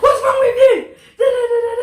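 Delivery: high, loud retold yell with a laugh; hammed-up angry girlfriend shouting; mock shout. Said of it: "This is like the first time she shouts".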